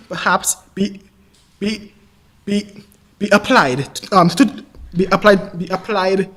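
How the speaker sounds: halting repeats with gaps between; real stammer, stuck on short words. Real, not acted. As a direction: keep restarting the phrase until it comes out. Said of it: "I may stammer from time to time".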